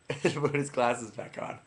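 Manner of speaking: usual speaking voice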